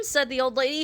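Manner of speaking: ordinary delivery, nothing marked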